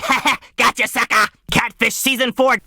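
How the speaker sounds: high, gloating prank-caller voice; high hammed-up triumphant taunt; Neve. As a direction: crow the gotcha with a mocking laugh. Imitates Neve gloating on phone.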